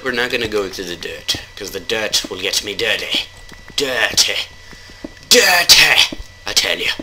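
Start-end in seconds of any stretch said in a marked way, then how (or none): 3.8-4.4 lightly raised, drawn-out word; first step of a mock build-up; playful emphasis. Player joking about getting dirty, playful emphasis. 5.3-6.2 loud, high-pitched exclamation; mock outburst. Player joking about getting dirty, mock outburst. Repeats dirty, louder second time.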